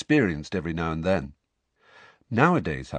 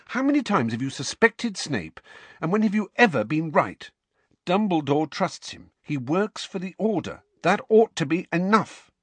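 indignant scolding; 8 semitones higher than usual. Acted. Hermione scolding Ron.

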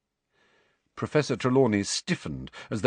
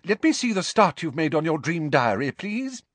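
brisk; 9 semitones higher than usual. Acted. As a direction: snap into action. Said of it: "uncharacteristically brisk".

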